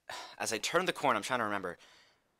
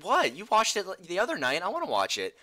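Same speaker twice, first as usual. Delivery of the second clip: put-on higher childlike voice; little brother; 8 semitones higher, 4 dB louder than usual. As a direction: play the innocent little kid. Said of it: "he's like".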